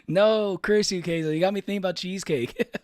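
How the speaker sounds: loud, high playful cursing; mock outrage. Real, not acted. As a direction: shout it like a comic villain. Playful outburst blaming a chatter.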